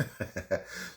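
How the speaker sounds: low, quiet chuckle; amused laugh. Real, not acted. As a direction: laugh softly to yourself. Cuts off the played clip.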